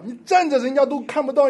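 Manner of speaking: ordinary delivery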